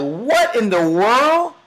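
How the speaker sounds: high, shocked cry at the burned cash; stunned disbelief. Retold or imitated. Son sees his dad burn money, shocked disbelief. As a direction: play the son gaping in disbelief. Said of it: "the son is looking like".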